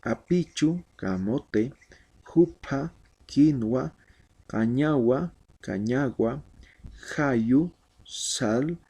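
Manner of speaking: clear, measured drill of Aymara vocabulary; pronunciation drill. Shown as a demonstration. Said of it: "vamos a repasar lo que es la pronunciación". (repaso de pronunciación, en voz alta)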